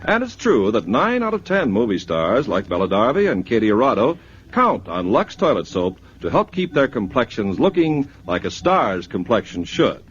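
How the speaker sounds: ordinary talk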